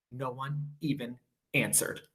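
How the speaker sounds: ordinary delivery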